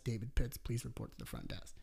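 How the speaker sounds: fading echoey PA repeat; intercom page. Retold or imitated. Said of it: "I get a page over the intercom".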